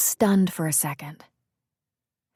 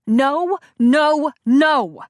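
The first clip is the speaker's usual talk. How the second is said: loud refusal; 8 semitones higher, 6 dB louder, much slower than usual. Acted. Bree refusing loudly.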